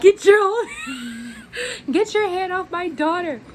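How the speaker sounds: high, loud mock yell through laughter; mom shouting. Retelling mom's defense of her daughter as a high laughing yell. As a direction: yell it like the protective mom, cracking up.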